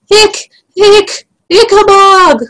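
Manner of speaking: high, stammered moan of fear; King Fred moaning. Acted. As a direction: squeak out the word in dread. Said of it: "moaned Fred".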